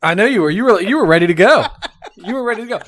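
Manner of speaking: laughing loudly while teasing. Real, not acted. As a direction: tease him through big laughs. Teasing about Wilson mistake.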